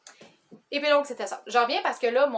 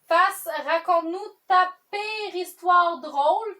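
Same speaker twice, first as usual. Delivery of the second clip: slow, louder, higher announcing read; question read-out; 8 semitones higher, 6 dB louder, much slower than usual. Real, not acted. Reading question seven to viewers, slow announcer-like read. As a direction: read the card out with drawn-out emphasis.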